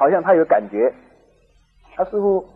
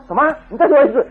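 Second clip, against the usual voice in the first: much higher, louder mock disbelief; disbelieving; 9 semitones higher, 8 dB louder than usual. Husband pretending not to hear his wife, loud and incredulous. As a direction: throw the demand back, incredulous. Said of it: "他先生不敢相信".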